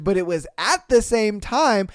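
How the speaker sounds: ordinary talk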